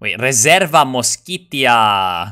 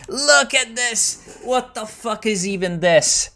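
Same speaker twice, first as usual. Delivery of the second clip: much higher, stretched-out disbelief; incredulous outburst; 11 semitones higher, slower than usual. Real, not acted. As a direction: shriek at the screen in disbelief. Outraged at game map.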